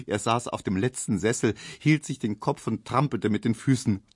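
ordinary delivery

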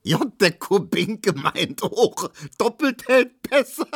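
high cry collapsing into laughter; calling out laughing. A narrator's reading. Neumann mocking Kubinke while holding his head, laughing. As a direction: squeeze the joke out before cracking up. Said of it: "rief Neumann".

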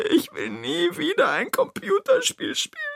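very high, tearful wail; crying Toni. Acted. Toni swears off computer games, crying in a high voice. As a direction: refuse it through tears. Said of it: "Toni schüttelt den Kopf".

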